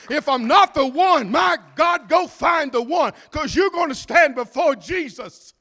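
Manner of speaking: high and loud pleading; preacher warning the people of judgment; fervent shout. Acted. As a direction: send them to find the one. Preaching at full force.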